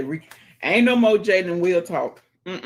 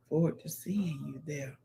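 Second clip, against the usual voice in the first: whisper; 4 semitones lower, 11 dB quieter than usual. Shown as a demonstration. Reading a comment in a whisper.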